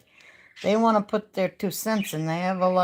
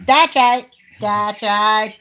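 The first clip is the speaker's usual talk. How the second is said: louder, punchy That's right; loud catchphrase; 2 semitones higher, 8 dB louder, much slower than usual. Pretend. Repeating her catchphrase to viewers, louder. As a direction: belt out the catchphrase.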